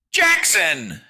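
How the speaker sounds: very high, loud call; piercing, drawn-out call; calling through door. A narrator's reading. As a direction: cry the name out, high and urgent. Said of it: "whispered from outside the door".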